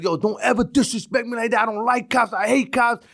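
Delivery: lightly voiced thug bravado, playing the part; tough thug act. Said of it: "get that thuggish out there / play that role".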